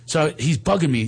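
usual speaking voice

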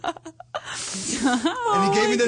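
telling the punchline and breaking up; cracking up at his own story, slow and high; laughing while telling. Real, not acted.

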